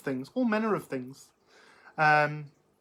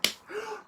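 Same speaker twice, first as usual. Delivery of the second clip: delighted gasp; 6 dB quieter than usual. Real, not acted. Gasp at merch idea.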